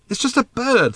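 impatient. A narrator's reading. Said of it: "said Arthur impatiently".

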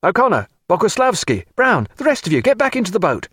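high, brisk command; crying out orders. A narrator's reading. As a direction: call the men back, pitched high. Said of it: "cried Babington".

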